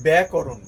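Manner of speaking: ব্যাকরণ as byæ-ko-ron, firmly; correct pronunciation. Shown as a demonstration. Teacher correcting the common error, correct pronunciation. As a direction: say ব্যাকরণ with a rounded short 'ko', no 'kaa'. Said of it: "এটা".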